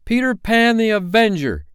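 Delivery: high, ringing heroic proclamation; terrible answer. A narrator's reading. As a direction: announce yourself as the dreaded avenger. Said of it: "came the terrible answer".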